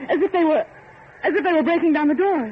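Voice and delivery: rising alarm at the noise. Acted.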